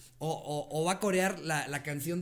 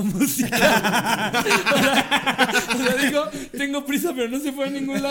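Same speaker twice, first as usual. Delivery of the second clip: loud, high, laughing disbelief; cracking up while retelling; high laughing indignation; laughing outrage; 8 semitones higher, 10 dB louder, slower than usual. Real, not acted.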